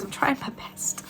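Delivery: a sheepish whisper of apology. Real, not acted. Apologising for stumbling words.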